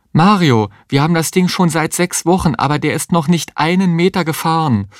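high-pitched, exasperated pleading; acted high, distressed complaint; desperate protest. A narrator's reading. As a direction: protest in rising despair. Woman in labour protests to Mario.

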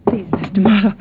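high, soft, anxious plea; urgent pleading. Acted. Niece stops the departing detective, urgent pleading.

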